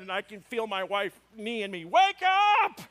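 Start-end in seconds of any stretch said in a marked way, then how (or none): 1.9-2.8 high, loud shout to rouse someone; sudden hammed-up holler; mock yell. Wife rousing her fainted husband, mock yelling.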